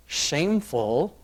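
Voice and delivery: normal talking voice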